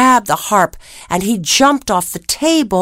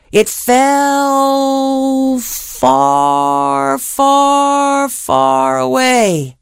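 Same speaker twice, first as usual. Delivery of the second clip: very slow, stretched and higher; drawn-out fall; 5 semitones higher, much slower than usual. A narrator's reading. Storyteller stretches the beanstalk's fall, very slow. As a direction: let it fall forever.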